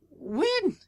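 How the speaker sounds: high, startled one-word question; shocked. Retold or imitated. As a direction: squeak it out in disbelief. Shocked friends moved away.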